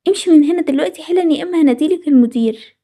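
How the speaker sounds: ordinary talk, nothing marked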